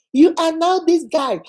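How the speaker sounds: ordinary talk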